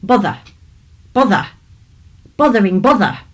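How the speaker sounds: cross voice. Acted. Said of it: "he heard a cross voice".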